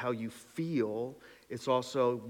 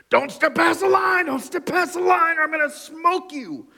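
booming, much higher and louder mock threat; big angry God; 11 dB louder than usual. Preacher mocking angry God's threat to his people. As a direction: thunder it like a furious god. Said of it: "Big angry God saying".

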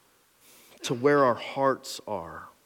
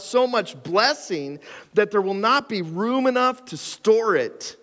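higher and louder, pressing the Lord's challenge home; preaching scripture; 7 semitones higher, 3 dB louder than usual. Acted. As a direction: read it like a prophet calling the people back. Reads Malachi with preaching force.

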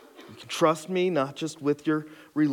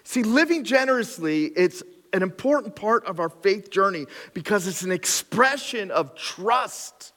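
preaching at full force; 6 semitones higher than usual. Acted. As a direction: drive the point home with fire. Continues preaching intensity.

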